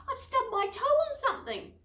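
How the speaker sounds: high and loud, hurting; pained cry. A narrator's reading. Girl tells friends she stubbed her toe, crying out. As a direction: complain through the sting of pain. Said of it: "cried Penny".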